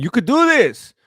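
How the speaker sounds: hammed-up cornerman's encouragement, pitch swooping on "do"; imitating a coach's insistent pep talk; mock corner pep talk. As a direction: cheer on a losing fighter unconvincingly. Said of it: "They're telling him".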